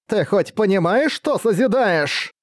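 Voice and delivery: high, slow disbelieving cry; almost scream it in disbelief; near yelling. Acted. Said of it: "воскликнула практически вопя Линка".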